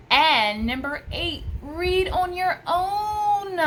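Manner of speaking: high, loud, drawn-out cry of the tip title; excited announcement. Real, not acted. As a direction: sing out the tip like big news.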